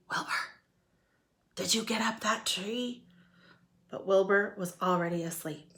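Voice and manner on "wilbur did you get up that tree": whisper. Acted. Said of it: "Elmer smiled and whispered".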